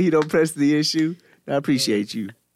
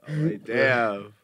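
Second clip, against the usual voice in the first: ordinary talk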